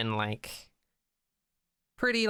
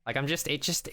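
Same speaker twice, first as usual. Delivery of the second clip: ordinary delivery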